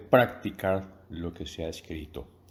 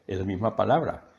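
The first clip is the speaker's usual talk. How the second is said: ordinary delivery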